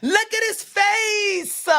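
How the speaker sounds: high, drawn-out mock shock; exaggerated high-pitched dismay; mock horror. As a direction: wail it slowly, hammed-up and aghast. Acts horrified fan seeing boxer's face.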